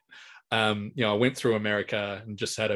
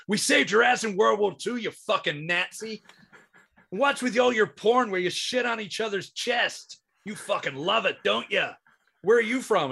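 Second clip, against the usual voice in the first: ranting American comic; 9 semitones higher than usual. Retold or imitated. Comedian abusing backpackers in the crowd, high mocking rant.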